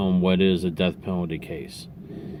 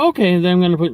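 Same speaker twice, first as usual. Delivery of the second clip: hurried pollster; 11 semitones higher, 9 dB louder, much faster than usual. Retold or imitated.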